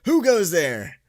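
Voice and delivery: mock fright at a tap on the window; mock startled. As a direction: jump and challenge the noise. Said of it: "and I'm like".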